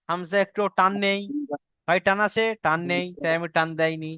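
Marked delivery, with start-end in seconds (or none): none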